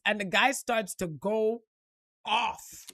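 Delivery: ordinary talk, nothing marked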